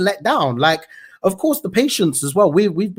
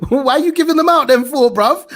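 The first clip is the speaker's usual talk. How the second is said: higher and louder, laughing through the words; laughing disbelief; 8 semitones higher, 5 dB louder than usual. Real, not acted. Vlogger mocking the doctor's advice, laughing disbelief. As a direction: scoff at it while still laughing.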